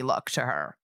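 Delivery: usual speaking voice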